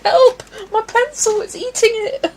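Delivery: very high, loud comic wail; mock scared. Streamer reliving sharpener eating the pencil, comic terrified wail. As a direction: squeal for help through laughter. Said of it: "that was scary".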